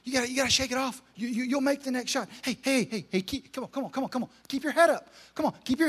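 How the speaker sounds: soft, coaxing sideline encouragement; gently rally the kids; encouraging coach. Retold or imitated. Coach encouraging upset players from the sideline, softly. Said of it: "constantly be saying".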